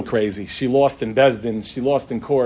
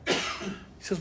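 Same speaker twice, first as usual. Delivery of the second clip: normal talking voice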